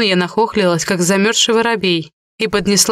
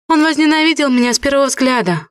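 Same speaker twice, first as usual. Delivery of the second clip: grumbling; 7 semitones higher than usual. A narrator's reading. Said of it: "хмуро буркнула я".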